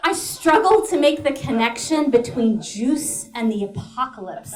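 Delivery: ordinary talk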